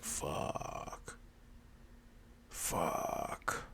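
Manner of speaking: whispered curse, drawn out; sinking, voiceless realization; hushed dismay. Acted. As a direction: whisper it slowly under the breath. Dreading a trap in story.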